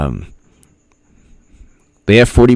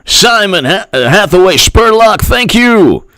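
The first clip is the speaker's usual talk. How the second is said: impression; 11 semitones higher than usual. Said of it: "H A Goodman does the best impersonations".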